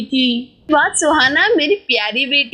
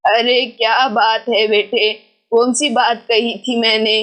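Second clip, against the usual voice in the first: put-on old grandmother; 4 semitones lower than usual. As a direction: speak low and unhurried like an elderly grandma. Grandmother voiced by narrator.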